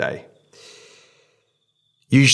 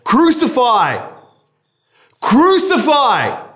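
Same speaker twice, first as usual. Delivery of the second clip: high, loud, slow shouted chant, climbing higher; crowd shouting crucify; 7 dB louder, much slower than usual. Acted. Chief priests shout at Pilate for crucifixion, loud and high. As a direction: bellow it like a mob baying for blood. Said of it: "they shouted".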